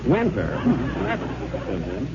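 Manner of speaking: ordinary talk, nothing marked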